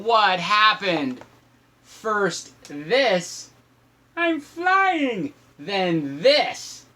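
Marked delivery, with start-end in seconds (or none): 4.2-5.3 high, gleeful cry of flight; high gleeful I'm flying; excited. Acted. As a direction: squeal it like a flying monkey. Voicing George flying.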